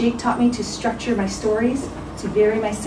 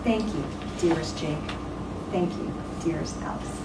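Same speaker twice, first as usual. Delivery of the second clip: soft heartfelt thanks; 5 dB quieter than usual. Acted. Closing thanks to the honorees.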